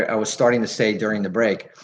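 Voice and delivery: normal talking voice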